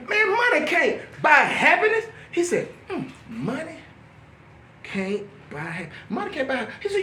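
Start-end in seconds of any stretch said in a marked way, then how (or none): 0.1-2.0 high, emphatic disbelief; incredulous rebuke. Retold or imitated. Telling a begging addict off, incredulous high rebuke. Acted rebuke, then pondered reply. 2.9-6.7 soft, halting, thinking aloud; pondering. Retold or imitated. Addict mulling over the saying, soft and halting.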